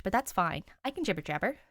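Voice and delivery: ordinary delivery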